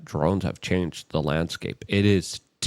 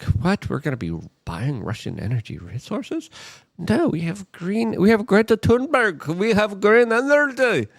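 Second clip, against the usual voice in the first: high, sing-song smug scoffing; mocking Germans bragging about green energy; smug mockery; 11 semitones higher than usual. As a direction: scoff like a self-satisfied diplomat. Mocking laughing German diplomats.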